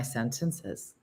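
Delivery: "sentences" with a slight lift at the end; lightly rising tune on "sentences"; upturned ending. Real, not acted. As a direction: let "sentences" tip upward like a question. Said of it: "just like that. I just went up".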